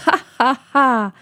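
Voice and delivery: acted, spelt-out ha-ha-ha laugh; mocking villain laugh. A narrator's reading. Escaped convict laughs at the boy's question, mocking laugh. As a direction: laugh scornfully in three separate beats. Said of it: "lachte der Mann".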